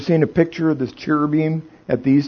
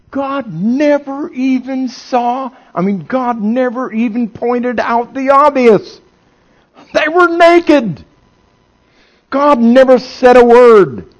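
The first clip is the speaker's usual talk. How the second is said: high, loud preaching at full force; fiery preaching; 13 semitones higher, 9 dB louder than usual. Acted. Preacher rises again.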